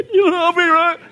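high, laughing joke. Real, not acted. Pastor laughing at the clip with the congregation. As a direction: crack up while saying it.

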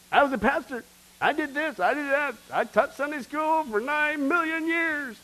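high, puffed-up list of deeds; a self-satisfied pastor boasting; mock bragging. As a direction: show off every church job. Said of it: "So where's the boasting?".

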